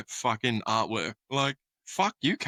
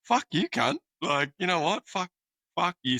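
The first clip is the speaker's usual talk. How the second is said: retold angry outburst; 7 semitones higher than usual. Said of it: "And I was like".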